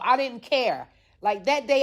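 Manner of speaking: normal talking voice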